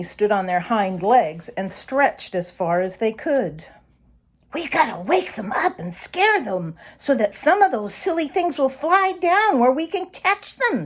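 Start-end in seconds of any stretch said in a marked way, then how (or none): 4.5-10.9 higher, eager fox voice; young fox pitched higher with eagerness. A narrator's reading. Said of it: "said Reddy, licking his lips hungrily".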